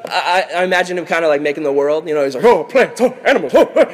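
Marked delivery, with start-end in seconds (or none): 2.4-3.9 high, loud bursts of conjuring; hammed-up excited magician popping things into being; Genie from Aladdin. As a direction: exclaim each one high and loud. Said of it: "kinda like the genie in Aladdin".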